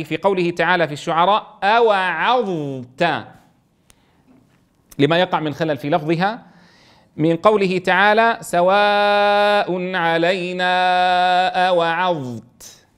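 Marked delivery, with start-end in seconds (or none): 1.6-3.2 أوعظت with a clear ظ kept apart from the ت; slow recitation. Shown as a demonstration. Teacher to students, reciting أوعظت slowly. 8.4-12.9 slow, drawn-out recitation; Quran recitation. Shown as a demonstration. Teacher to students, reciting the verse slowly.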